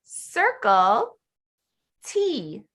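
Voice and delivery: slow, spaced-out teacher command; teacher modeling. Shown as a demonstration. Teacher gives student a short instruction, slow modeling. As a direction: give each word its own beat.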